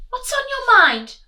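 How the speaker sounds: high, loud lady's voice; reply graciously; grand lady reply. Acted.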